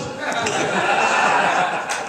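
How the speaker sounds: breathy high laugh at own story. Real, not acted. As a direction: laugh up high after the punchline. Laughing after punchline.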